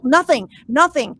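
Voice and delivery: ordinary talk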